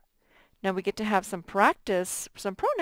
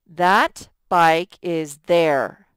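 slow and louder, testing "there"; slow wrong version; 6 dB louder, slower than usual. Shown as a demonstration. Teacher tests the wrong word slowly for the class. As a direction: read it slowly to hear "there" fail. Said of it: "We would use the pronoun theirs".

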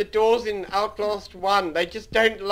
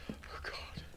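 whispered dread; 23 dB quieter than usual. Real, not acted. Trapped in dead end.

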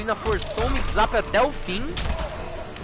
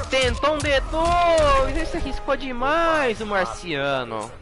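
excited caster call; 6 semitones higher, slower than usual. Real, not acted. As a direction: let it ring out over the crowd. Caster calling a risky move.

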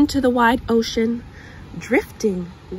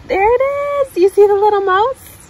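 louder, higher, quick and delighted; excited discovery; 10 semitones higher, 9 dB louder, much faster than usual. Real, not acted. Showing the child the little mouse, excited.

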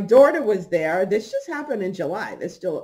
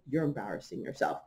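quiet; 8 semitones lower, 12 dB quieter than usual. Retold or imitated. Quiet rebuke to daughter.

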